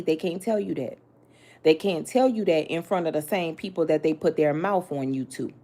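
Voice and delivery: ordinary delivery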